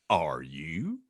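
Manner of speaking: low gentle giant voice, rising; Hagrid's question. Acted. Hagrid asking the aunt, low gentle voice.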